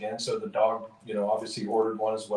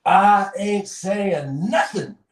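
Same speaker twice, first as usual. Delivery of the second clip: higher, louder, slow, stubborn dog voice; a dog refusing to talk, higher and slow; 9 semitones higher, 5 dB louder, slower than usual. Retold or imitated. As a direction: hold out for the money like a sly cartoon dog. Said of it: "the dog replies back".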